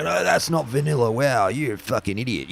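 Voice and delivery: high-pitched jeering imitation; mock aggressive scorn. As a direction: imitate an outraged purist berating a player. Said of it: "they get really aggressive about it".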